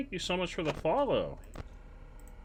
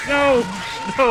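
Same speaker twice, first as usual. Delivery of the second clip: sudden loud panicked no; panicked shout; 10 semitones higher, 12 dB louder than usual. Real, not acted. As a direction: yell it in real panic.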